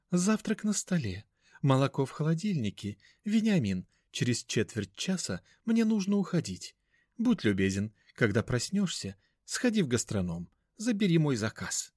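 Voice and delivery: high, mannered instructions; a grand dame giving orders; affected lady voice. A narrator's reading. Мать даёт указания сыну. (высокий манерный голос)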